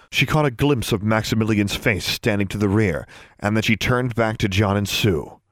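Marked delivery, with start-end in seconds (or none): none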